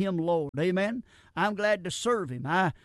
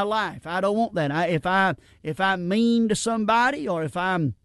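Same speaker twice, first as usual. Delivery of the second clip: louder preaching, pressing each point; full-force preaching; 5 dB louder than usual. Acted. Preacher urges the congregation, building to full force. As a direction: preach it out to the pews.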